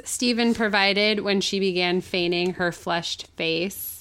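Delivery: ordinary delivery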